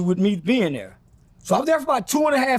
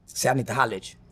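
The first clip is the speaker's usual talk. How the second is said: lightly voiced big bodyguard, much lower and softer; big guard's voice; 11 semitones lower, 4 dB quieter, much faster than usual. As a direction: drop low, like a huge guy leaning down to talk. Voicing the big muscular guard.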